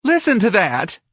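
high, loud delighted exclamation; happy cry. A narrator's reading. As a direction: cry it out happily as the thrilled wife. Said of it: "Missus Levy cried happily".

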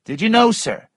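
accusing Missus Waters. Acted. Narrator voices Missus Waters.

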